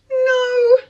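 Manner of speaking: very high, drawn-out no; coy refusal. Retold or imitated. To the man asking for her number, high coy refusal. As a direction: squeak out a playful refusal.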